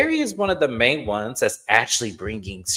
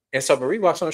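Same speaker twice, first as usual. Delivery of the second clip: ordinary talk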